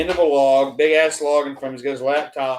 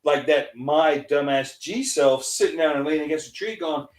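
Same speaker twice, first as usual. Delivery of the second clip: normal talking voice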